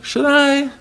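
high mock questioning voice. Shown as a demonstration. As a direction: lift it up high like a doubtful question. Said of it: "not a question".

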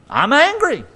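sudden high angry cry; angry outburst. Real, not acted. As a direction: burst out angrily high. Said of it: "I get angry".

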